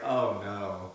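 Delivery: usual speaking voice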